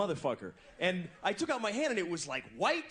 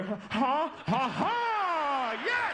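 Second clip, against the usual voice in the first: thrilled cheering; 10 semitones higher, much slower than usual. Retold or imitated. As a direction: celebrate like you just won. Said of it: "And then if she starts".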